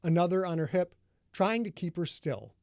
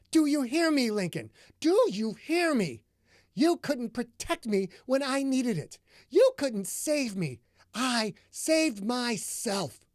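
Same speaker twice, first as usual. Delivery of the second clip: furious accusation; 7 semitones higher than usual. A narrator's reading. Delilah confronts Lincoln furiously.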